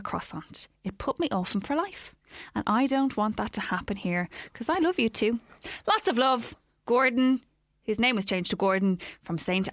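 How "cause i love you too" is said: ordinary talk, nothing marked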